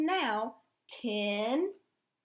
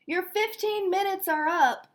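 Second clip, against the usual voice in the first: higher, a bit louder call; hollering; 6 semitones higher, 4 dB louder than usual. Acted. Referee Jake calling the players back, a mild holler. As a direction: call the teams back onto the field. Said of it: "hollered Jake".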